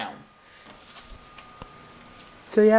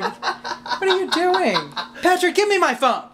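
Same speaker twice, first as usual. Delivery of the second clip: laughing; 13 semitones higher than usual. Retold or imitated. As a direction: beg through laughter. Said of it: "And I'm like".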